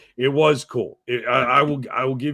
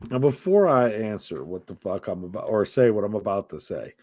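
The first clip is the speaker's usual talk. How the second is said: normal talking voice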